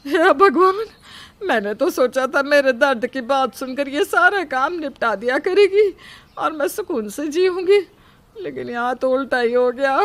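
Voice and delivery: high-pitched, whining dismay; dismayed lament. Acted. Mother-in-law whose plan to rest backfired, lamenting in dismay. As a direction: wail mildly that the plan has backfired.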